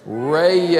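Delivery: "rest" held very long as "ray-yest"; luxuriously stretched Southern "ray-yest"; slow Southern rest. Shown as a demonstration. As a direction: let "rest" melt into two slow syllables. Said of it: "if you say it right, it just takes you there".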